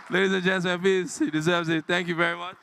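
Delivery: rally the crowd to clap for him; excited applause call. Real, not acted. Host rallies the audience to applaud, loud and gleeful.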